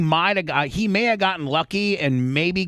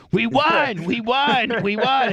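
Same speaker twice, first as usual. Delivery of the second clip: higher, repeated gleeful we won; retold excited cheer; 8 semitones higher, slower than usual. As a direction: relive the win, chanting it high. Retold cheering to Cocaine Hank.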